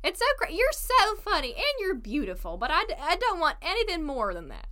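high, sing-song mock admirer; mock gushing guy. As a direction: play a guy brushing her off sweetly. Voicing a guy's dismissive compliment.

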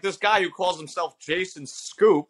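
slow, slightly disdainful quoting; mildly condescending. Retold or imitated. Comedian imitates the newspaper's write-up of him, mildly condescending. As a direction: look down on the name a little. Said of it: "little condescending".

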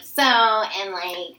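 put-on airy, rambling valley girl. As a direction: play a ditzy valley girl student. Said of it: "sounding like a valley girl".

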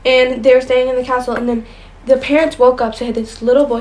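ordinary talk

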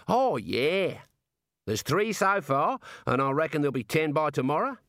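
chatty Aussie warder; warder with Australian accent. Acted. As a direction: play an easygoing Australian prison guard.

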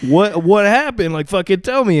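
lightly acted alarm; retold alarm. Retells demanding news from dad.

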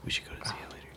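hushed whisper. Real, not acted. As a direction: whisper it secretly. Whispered aside about seeing it.

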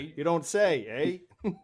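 loud hammed-up Canadian accent. Host playing Canadian for laughs, Canadian accent. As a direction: ham up a Canadian reply. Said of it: "That, that Canadian accent".

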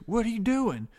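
high, loud, baffled character voice; indignant prisoner. Acted. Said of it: "and he goes".

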